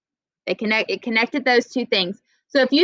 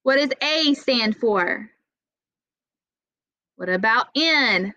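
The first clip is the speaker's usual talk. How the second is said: ordinary talk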